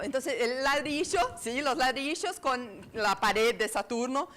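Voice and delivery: normal talking voice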